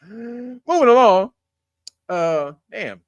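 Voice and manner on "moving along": announcer segue. Pretend. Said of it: "host segue".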